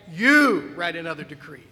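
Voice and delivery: "You" said loudly and emphatically; stress on you. Shown as a demonstration. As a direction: hit "You" hard, then "write another decree" in an ordinary voice. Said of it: "look at the word "you".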